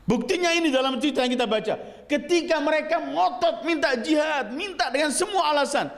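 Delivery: sustained high-pitched sermon delivery; impassioned preaching. Real, not acted. Preacher exposing those who demanded a leader, high insistent preaching. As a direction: press the accusation, voice held high.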